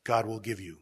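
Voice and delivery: measured, solemn scripture reading; gospel reading. Acted. Lector reads the gospel to the congregation, solemn. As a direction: read the lesson to the congregation.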